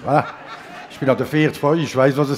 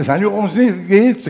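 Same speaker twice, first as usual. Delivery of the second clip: cheery greeting; 5 semitones higher, 6 dB louder than usual. Retold or imitated. Schengel greeting Roger.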